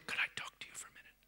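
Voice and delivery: quick, hushed private request; gentle whisper. Acted. God asking for a moment, whispering. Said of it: "He'd like to be able to just go".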